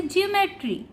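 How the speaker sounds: ज्योमेट्री starting on ज, pitched high. Shown as a demonstration. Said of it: "ज के रूप में किया जाता है".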